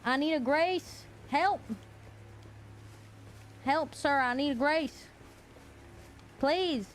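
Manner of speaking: high, hammed-up begging; mock pleading. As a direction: beg for rescue in a high, put-on voice. Mock begging for help in game.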